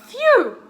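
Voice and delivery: high, loud relieved phew; dog relieved the danger is over, high phew. A narrator's reading. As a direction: blow out a big phew of relief. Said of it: "said the dog".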